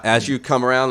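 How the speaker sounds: ordinary talk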